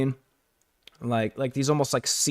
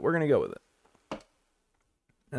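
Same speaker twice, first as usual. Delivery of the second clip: slow, quieter resigned repeat; resigned drawl; 7 dB quieter, slower than usual. Real, not acted. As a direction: drag it out, resigned. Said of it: "We're gonna, we're gonna go with it".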